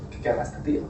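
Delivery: breathy, hushed inner question; muttered thought. Pretend. A tourist wondering about a stranger, hushed and low. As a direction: mutter it to yourself, puzzled.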